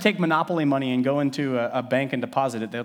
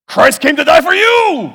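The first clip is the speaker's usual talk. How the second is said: much higher and louder, slow furious yell; angry shout; 16 dB louder than usual. Shown as a demonstration. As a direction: bellow it at them like a scolding preacher. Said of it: "his tone was all off".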